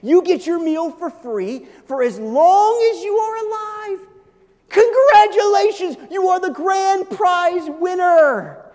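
high, loud game-show announcement; mock prize announcer. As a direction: sell it like a grand prize reveal. Preacher plays a prize announcer.

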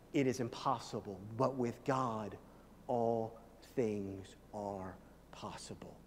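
fading to whisper. Acted. Preacher voicing Jesus to disciples, fading hushed whisper. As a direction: draw them in, ending in a slow whisper.

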